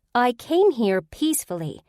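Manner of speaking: loud sharp angry bark; barking angrily. A narrator's reading. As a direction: snap it out in anger. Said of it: "Kaveri barked".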